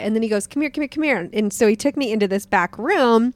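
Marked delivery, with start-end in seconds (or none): 0.5-1.3 lightly imitated excited kid, higher pitch; eager beckoning. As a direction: urge someone along in quick repeats. Acting out kid eagerly beckoning her over.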